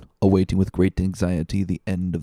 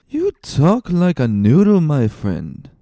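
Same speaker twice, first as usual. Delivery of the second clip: put-on drunk man; 7 semitones higher, 6 dB louder, slower than usual. Drunk man mocking Fernand.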